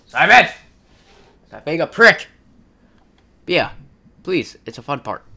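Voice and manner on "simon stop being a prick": loud angry yell at a friend; angry shout. Real, not acted. Yelling at Simon to stop being a prick, angry shout. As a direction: shout him down, fed up.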